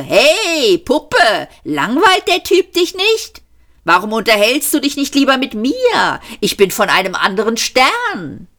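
high-pitched, mocking come-on; imitated pick-up artist. A narrator's reading. Arthur imitates the friend chatting up the woman, mocking. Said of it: "kreuzte ein Freund hier auf und sagte".

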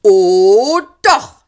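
high, loud shouted reply; shouting policeman. A narrator's reading. Policeman shouting back at Trillian, loud. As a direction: yell back smugly. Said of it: "schrie der Polizist".

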